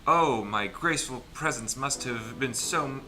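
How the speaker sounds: ordinary delivery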